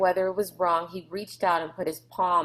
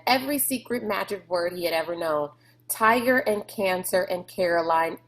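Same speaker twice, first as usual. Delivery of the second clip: plain reading voice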